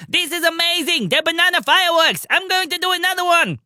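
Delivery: bursting with glee over the fireworks; excited high voice. A narrator's reading. Pim Pim thrilled by fireworks.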